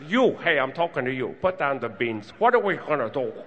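ranting local doing Al Pacino from Brooklyn; Chinese Al Pacino accent. Acted. Angry local ranting at the Yankee, in mock accent. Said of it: "Chinese Al Pacino from Brooklyn".

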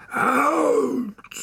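one long high bellow. A narrator's reading. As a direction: bellow the order at full volume. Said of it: "he bellowed".

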